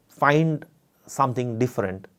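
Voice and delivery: ordinary talk, nothing marked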